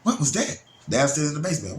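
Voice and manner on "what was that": startled question. Retold or imitated. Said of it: "heard that boom".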